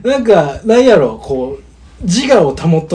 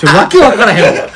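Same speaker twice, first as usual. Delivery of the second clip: laughing at himself; bursting out laughing mid-sentence; 5 semitones higher, 7 dB louder than usual. Real, not acted. Breaking character, laughing.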